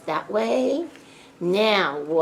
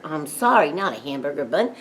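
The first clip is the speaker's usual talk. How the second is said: normal talking voice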